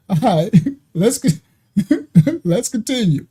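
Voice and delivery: slow words broken by laughter; can't stop laughing. Real, not acted. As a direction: try to go on but keep cracking up. Cracking up mid-sentence.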